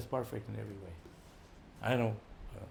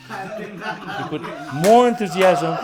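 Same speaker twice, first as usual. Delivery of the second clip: laughing urge; 8 semitones higher, 14 dB louder, much slower than usual. Real, not acted. Urging more enthusiasm, laughing.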